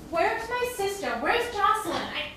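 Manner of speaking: high, urgent questioning; frantic demand. Acted. Captive demanding her sister, frantic. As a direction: demand answers in alarm.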